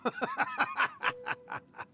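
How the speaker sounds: a high, drawn-out spectral laugh; eerie laugh. Acted. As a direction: laugh like a spirit stepping out of a painting. Spirit appears laughing in painting.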